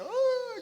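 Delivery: high drawn-out mock wail; imitated childlike crying. Preacher imitating the millionaire weeping like a child, mock wail. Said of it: "empieza a llorar como un niño".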